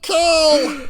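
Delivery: very high, loud, strangled gnome yell; gnome battle cry. Acted. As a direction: shriek the command like a tiny villain. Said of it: "and he's like".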